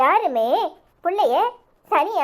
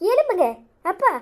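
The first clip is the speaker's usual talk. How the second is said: sudden high urgent cry to get up; alarmed plea; 6 semitones higher than usual. Acted. Daughter startled as her father kneels before her, urgent high plea. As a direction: jump up in pitch, begging him to rise.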